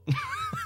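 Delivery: very high squeaky imitated noise. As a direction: make a quick high squeal. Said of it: "I've heard that noise many a time".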